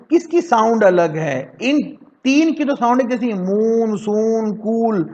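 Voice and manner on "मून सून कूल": ordinary talk, nothing marked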